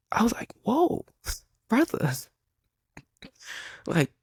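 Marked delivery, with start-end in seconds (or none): 0.6-2.1 very high startled exclamation; scared freshman reacting to the coach, high and shocked; shocked whoa. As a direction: recoil in surprise. Teen stunned by coach's hostility.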